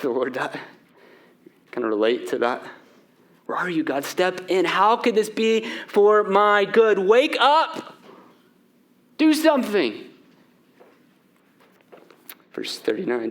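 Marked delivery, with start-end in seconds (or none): 3.5-10.1 rising, pleading and demanding; desperate prayer. Acted. Believer begging God in hardship, rising plea. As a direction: press God to wake up.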